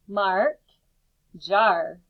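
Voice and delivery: normal talking voice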